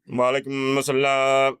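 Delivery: slow, low, drawn-out greeting; mock greeting. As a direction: intone it piously. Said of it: "Sound like".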